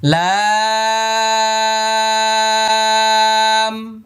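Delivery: লা held long and high; long lam. Shown as a demonstration. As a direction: draw out লা for four beats. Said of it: "আট নম্বর মাখরাজ". (লা চার আলিফ টেনে)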